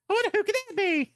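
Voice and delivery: very high, loud, quick mimicry; squeaky, hurried imitation of a question; mock high voice. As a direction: parody a voice shooting up high. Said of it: "why did my voice get so high".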